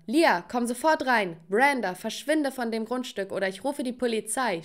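ordinary delivery

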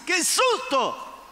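high, drawn-out mock cry; comic, stretched "ay" of fright; Peter startled. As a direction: ham up getting over a scare. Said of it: "Que Pedro diga".

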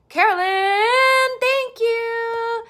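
high, loud, stretched-out gratitude; drawn-out excited squeal; gushing thanks. Real, not acted. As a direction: sing out the name with delight. Calls out thanks to a donor.

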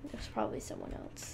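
fast, whispered humble-brag; sheepish fast whisper; whispered aside. Real, not acted. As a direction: mutter it quickly off-mic. Modest aside after boasting.

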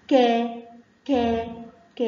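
ك said as a long "kaa"; long alif sound. Shown as a demonstration. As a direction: hold the "aa" after ك each time. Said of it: "المد بالألف بيحب الفتحة".

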